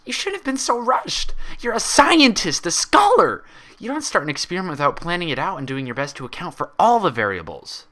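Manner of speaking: furious yell. A narrator's reading. Twilight yelling furiously at her own reflection. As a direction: scream at yourself in rage. Twilight grows angrier, yells.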